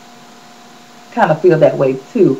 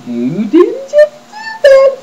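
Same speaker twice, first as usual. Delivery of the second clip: high, slow sassy disbelief; incredulous; slower than usual. Real, not acted. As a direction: drag it out like you're not buying it. Disbelief at [name]'s weight loss.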